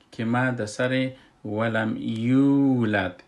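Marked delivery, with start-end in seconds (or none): 1.5-3.2 «ولم یولد» with the vowel drawn out for two beats; long vowel stretched. Shown as a demonstration. Teacher showing the stretched madd in «ولم یولد». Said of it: "ای را یک اندازه مد دادیم".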